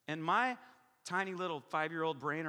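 usual speaking voice

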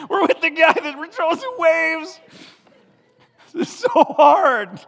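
high loud mock complaint; mock exasperated disciple. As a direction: whine it like a frustrated disciple. Still voicing disciples.

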